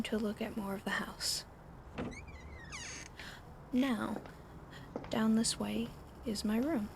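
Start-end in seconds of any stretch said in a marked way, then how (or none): none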